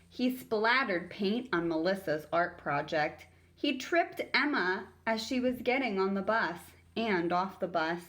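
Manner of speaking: ordinary delivery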